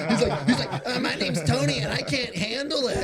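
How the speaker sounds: giggling mockery; laughing. Real, not acted. Mocking Tony to friends, laughing hard.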